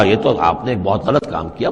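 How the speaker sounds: usual speaking voice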